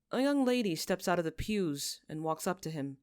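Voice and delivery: ordinary delivery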